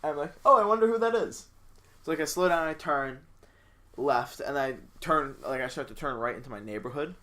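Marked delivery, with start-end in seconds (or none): 0.4-1.5 high, loud feigned curiosity; mock wondering. Driver to self as police lights come on, mock wondering. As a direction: play innocent in a raised, louder voice.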